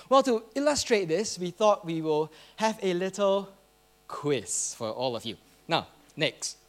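usual speaking voice

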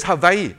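HAWAII as ha-VAI-ee, v for w. Acted. Customs officer settling a quarrel, Hawaii with v. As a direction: say Hawaii with a v in the middle. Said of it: "So the person replied".

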